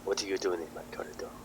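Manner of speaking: put-on line slipping into a French accent; soft, quick French-accented challenge. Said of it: "it's turned into French accent".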